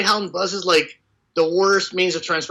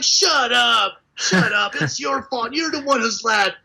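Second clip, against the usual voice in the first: teasing mock; 6 semitones higher, slower than usual. Everyone teasing Derek.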